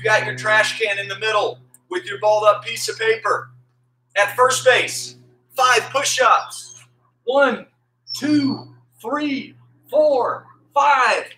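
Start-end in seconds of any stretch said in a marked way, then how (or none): 4.2-6.8 slow emphatic announcing. Shown as a demonstration. Coach announcing the exercise. 7.2-11.2 counting reps. Shown as a demonstration. Coach counting push-ups.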